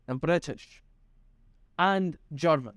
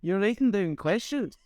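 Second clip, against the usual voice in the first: much higher hammed-up Canadian accent; 11 semitones higher than usual. Friends keep up a mock Canadian accent on the podcast. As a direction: ham up a Canadian voice. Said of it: "Do you want to kill the accents?".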